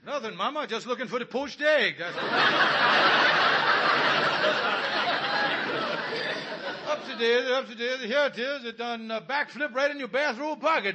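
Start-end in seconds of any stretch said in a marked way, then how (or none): 2.2-6.8 loud embarrassed laugh. Acted. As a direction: laugh it off nervously. Laughing at fallen egg.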